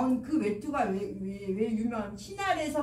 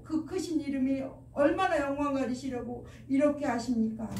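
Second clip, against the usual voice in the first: acted wailing appeal, pitched higher; crying out in prayer; 4 semitones higher than usual. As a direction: imitate a desperate lament in prayer. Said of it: "라고 이렇게 막 저녁 때까지 울부짖는".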